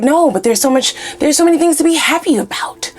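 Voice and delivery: rising into a very high, bubbly mock voice; mock cheery optimist. Mocking toxically positive people who dismiss anger, high and bubbly. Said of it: "They're like".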